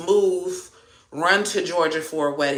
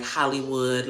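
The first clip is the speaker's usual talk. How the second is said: ordinary delivery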